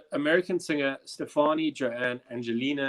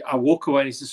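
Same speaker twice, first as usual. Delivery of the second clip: ordinary delivery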